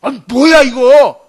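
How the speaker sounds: indignant outburst. Retold or imitated. Naaman indignant at servant message.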